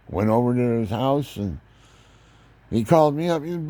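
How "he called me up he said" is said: ordinary delivery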